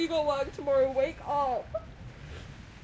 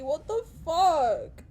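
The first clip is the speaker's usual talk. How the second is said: usual speaking voice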